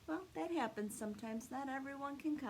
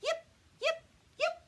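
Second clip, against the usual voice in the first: very high, louder yips; fox yipping; 6 dB louder than usual. Acted. Little fox at the fence calling again, very high yips. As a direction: yip like a small fox calling. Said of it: "She called again".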